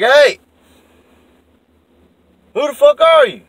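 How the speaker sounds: high, loud shout down the hill; hollering down. Retold or imitated. Calling down the driveway at a stranger, loud yell. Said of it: "I holler down there".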